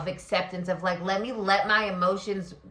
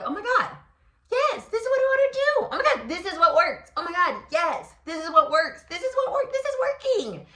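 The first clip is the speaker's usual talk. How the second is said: much higher, fast, thrilled squeal; ecstatic realization; 10 semitones higher, faster than usual. Pretend. Reader voices Leo's joyful aha moment, high and gushing. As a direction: gush like it just clicked.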